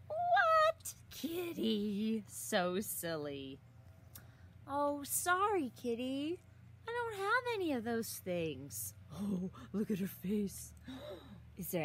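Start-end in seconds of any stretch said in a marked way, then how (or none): none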